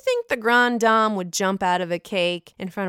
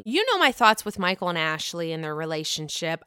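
ordinary delivery

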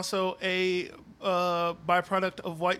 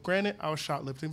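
normal talking voice

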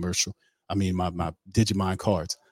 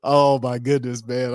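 slow, higher, giggly oh my goodness; laughing; 6 semitones higher, 5 dB louder, slower than usual. Real, not acted. Host amused by his own show, slow and laughing. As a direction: still laughing after the bit.

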